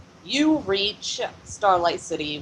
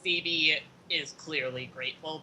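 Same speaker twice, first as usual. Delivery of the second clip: ordinary delivery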